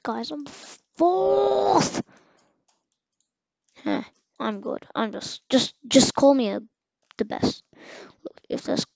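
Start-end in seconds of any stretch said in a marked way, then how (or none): none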